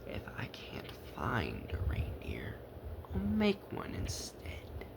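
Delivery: hushed, sly Grinch; scheming whisper. Acted. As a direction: whisper the trick to the dog. Grinch speaks.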